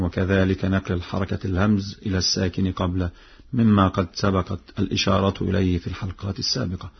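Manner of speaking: normal talking voice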